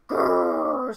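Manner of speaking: loud, very low rolling growl; bear growl. Acted. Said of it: "said the bear".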